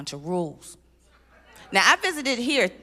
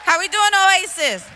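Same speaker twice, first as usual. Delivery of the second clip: high, loud, slow call to the room; crowd greeting shout; 10 semitones higher, 6 dB louder, slower than usual. Real, not acted. Comic greeting the Oasis crowd, loud and high. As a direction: rev up the audience.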